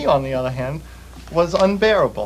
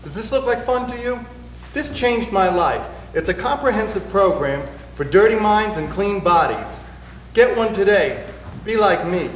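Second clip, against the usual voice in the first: mock ad pitch; 5 semitones higher than usual. Singer parodies a fitness commercial for the camera, higher-pitched. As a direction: sell it like a cheesy TV ad.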